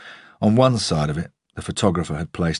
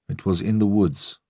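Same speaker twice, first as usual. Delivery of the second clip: put-on old groundsman, lightly Russian-accented; 3 semitones higher than usual. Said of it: "The accent was Russian".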